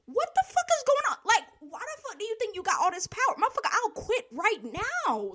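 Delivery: high, loud, fast retold confrontation; mock outraged yell. Retelling talking back to the boss, high and loud. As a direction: snap back at the power-tripping boss. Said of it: "I'm like".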